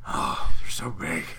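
hammed-up swooning sigh, slow and loud; hammed-up lustful gasp; mock swoon. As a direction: moan it like a lovesick fan, drawn out. Mock lustful bear fantasy.

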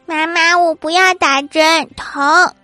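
put-on high, slow child's whine; little girl. Little daughter begging mum to skip the injection, whining. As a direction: plead like a small girl dreading it. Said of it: "小女儿说".

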